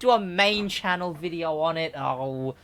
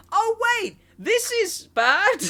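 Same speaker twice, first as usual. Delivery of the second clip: high, drawn-out mock realisation; mock dismayed players; 12 semitones higher, slower than usual. Players seeing the customization on the Z, mock dismay. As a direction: imitate players' dawning dismay, pitched up high. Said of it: "they were like".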